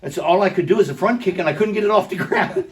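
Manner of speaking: loud, laughing at himself; laughing while telling. Real, not acted. Laughing at his own failed kick.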